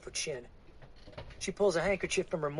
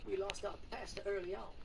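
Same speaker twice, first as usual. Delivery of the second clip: a higher, subdued woman's voice; weary woman; 6 semitones higher, 8 dB quieter than usual. Acted. Woman recounting her pastor's death, subdued. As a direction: tell it wearily.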